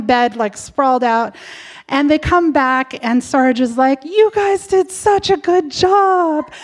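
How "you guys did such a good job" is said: cheery praise. Retold or imitated. Sarge praises, voiced higher.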